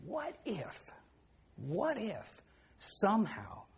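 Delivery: whispered, slow what if; hushed, hopeful musing; soft wondering. Real, not acted. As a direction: coax gently. Gentle suggestion to boy.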